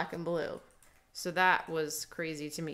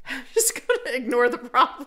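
laughing; 6 semitones higher, 5 dB louder than usual. Real, not acted. Joking confession with laughter.

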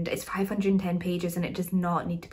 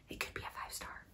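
hushed confiding whisper; barely audible secret; 19 dB quieter, faster than usual. Real, not acted. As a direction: whisper it so as not to jinx it. Reluctant secret after not wanting to jinx.

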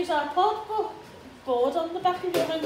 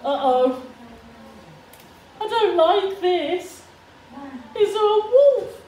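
mock scared; 10 semitones higher, 5 dB louder, slower than usual. Mock fear at the wolf.

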